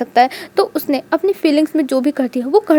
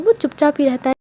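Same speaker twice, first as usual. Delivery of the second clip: ordinary delivery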